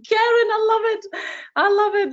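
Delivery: high, loud, laughing delight with a hint of tears; delighted squeal. Real, not acted. Host squealing with joy at Karen's comment. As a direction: gush over the comment like it made your day.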